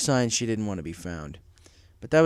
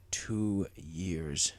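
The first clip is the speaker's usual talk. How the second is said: low, soft mutter; stunned father mutters to himself; 5 semitones lower, 8 dB quieter than usual. Acted. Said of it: "Stormcloud muttered".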